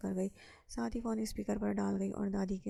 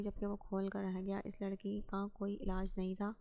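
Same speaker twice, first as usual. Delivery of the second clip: ordinary delivery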